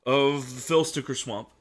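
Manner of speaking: loud, high, pompous title call; grand announcer. Acted. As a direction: proclaim the full title with pomp.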